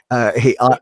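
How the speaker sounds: ordinary delivery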